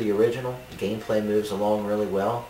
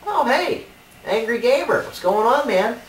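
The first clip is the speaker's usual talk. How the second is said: high, loud cheery hello; excited greeting; 14 semitones higher, 6 dB louder than usual. Acted. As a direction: greet him with big enthusiasm. Cheery greeting to Angry Gamer.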